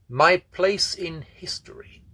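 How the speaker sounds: slow, high, drawn-out proclamation; portentous announcement. A narrator's reading. Teacher's essay title revealed on the blackboard, slow and portentous. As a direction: announce it grandly, stretching each word.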